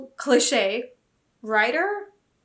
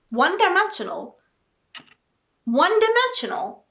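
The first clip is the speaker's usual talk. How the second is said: higher and louder, repeating the insult; indignant disbelief; 7 semitones higher, 4 dB louder than usual. Real, not acted. Quoting the review back at it, high indignant disbelief. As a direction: spit the word back in disbelief.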